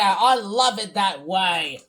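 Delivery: usual speaking voice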